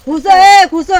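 high, very loud command; real exasperated shout; sharp scolding order. Real, not acted. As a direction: shout them down into their seat. Elder orders agitated child to sit.

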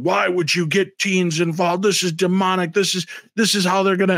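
high-pitched mock outrage; livid objectors. Livid people object to teens ghost hunting, mock outrage. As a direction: imitate indignant critics, pitched well up. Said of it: "people were livid".

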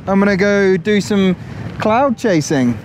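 normal talking voice

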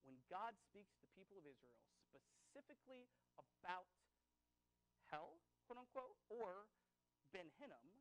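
normal talking voice

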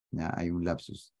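usual speaking voice

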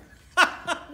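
high, loud jeering laugh; mocking burst of laughter. Big brother laughing at the drawing, high loud jeering laugh. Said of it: "Leon burst out laughing".

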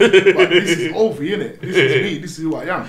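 laughing proudly. Real, not acted. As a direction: burst out proudly through a laugh. Proudly naming himself, laughing.

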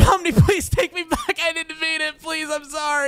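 high, wailing apology; mock crying plea. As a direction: beg in a hammed-up tearful wail. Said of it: "are you crying".

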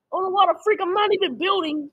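ordinary delivery

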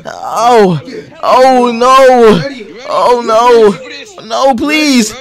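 shouting while wounded. Acted. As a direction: cry out in pain, legs hurt. Acts being wounded in game.

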